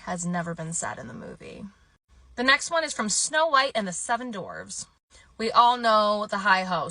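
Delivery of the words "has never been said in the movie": ordinary talk, nothing marked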